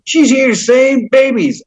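slow, high mock of the lieutenant governor; mocking quote. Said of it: "And so I got triggered".

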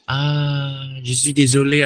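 penjual menolak dengan nada menyesal, sedikit lebih tinggi; pura-pura jadi penjual yang minta maaf; apologetic seller. Retold or imitated. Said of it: "kalau udah misalnya kayak". (penjual pasar menolak tawaran pembeli, menyesal)

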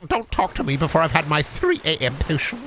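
grumpy wizard before his potion. Acted. Keeps the wizard voice going.

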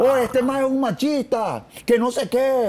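high, slow, sneering heckler voice; sneering panto villain. Retold or imitated. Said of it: "decía la maje".

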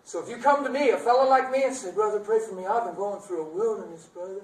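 loud, high preaching call; fervent preaching. Acted. Preacher to the congregation, loud and high fervent.

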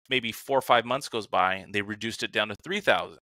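ordinary delivery

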